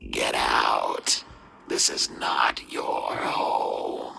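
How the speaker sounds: very deep, loud, distorted monster growl; slow, booming, rasping demon voice; creature's menacing whisper. A narrator's reading. Creature in the old man's ear, deep menacing rasp. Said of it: "opened his mouth to whisper in his ear".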